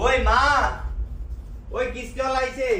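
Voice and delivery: high-pitched call to mother. Acted. Child calling mother about the loan collector, calling out. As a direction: call out high, as if to another room.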